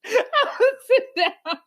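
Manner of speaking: high, slow, words squeezed out through laughter; can barely talk for laughing. Real, not acted. Telling friends her sign mistake, laughing high through the words.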